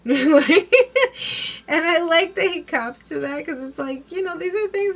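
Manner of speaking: loud, high laugh into the words. Real, not acted. Amused that Donald admits it, loud laughing voice. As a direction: laugh it out while talking.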